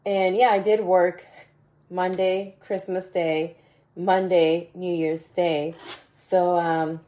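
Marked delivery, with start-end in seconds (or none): none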